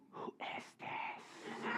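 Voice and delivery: hushed, slow mock whisper; drawn-out whispered interrogation; suspicious whisper. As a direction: whisper it slowly, demanding to know. [name]'s suspicious hushed question.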